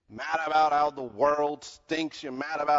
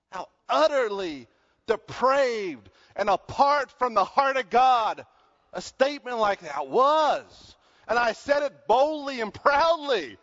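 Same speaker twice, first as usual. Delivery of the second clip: preaching at full force; 6 semitones higher, 3 dB louder, slower than usual. Real, not acted.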